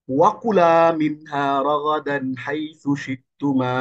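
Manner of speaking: slow chanted Quranic recitation; slow melodic tilawat. Shown as a demonstration. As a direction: draw out each Arabic word as in recitation. Recites Quran verse slowly.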